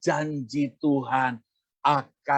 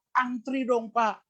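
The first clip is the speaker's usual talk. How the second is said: retold yell; 7 semitones higher, slower than usual. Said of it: "Dia teriak depan banyak orang".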